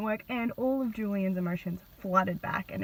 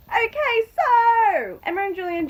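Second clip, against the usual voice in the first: excited; 12 semitones higher, 9 dB louder than usual. Real, not acted. Sudden outburst after a pause.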